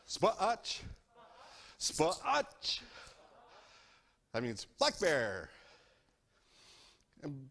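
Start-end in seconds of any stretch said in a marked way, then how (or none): 0.1-2.8 Spot Hutch as two clear stressed syllables, rising; teacher saying Spot Hutch clearly; Spot Hutch said slowly. Shown as a demonstration. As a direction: model "Spot Hutch" for kids to repeat. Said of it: "Can you say Spot Hutch?".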